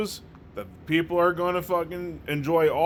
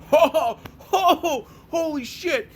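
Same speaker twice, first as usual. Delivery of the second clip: exaggerated fake crying-laughter, much higher voice; hammed-up laughing fit; 12 semitones higher than usual. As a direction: ham up laughing to tears, gasping for air. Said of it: "No, nothing like".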